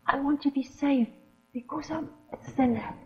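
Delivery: put-on hushed, soft child's whisper; a girl whispering her confession. A narrator's reading. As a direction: breathe it into his ear. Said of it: "she whispered in his ear".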